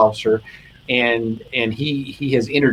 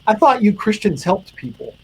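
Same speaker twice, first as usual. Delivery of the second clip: high, emotion-choked retelling; getting emotional; 8 semitones higher than usual. Real, not acted. Officer at wit's end to chaplain, high and emotional. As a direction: voice lifting as real emotion takes over. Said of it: "I'm gonna get emotional".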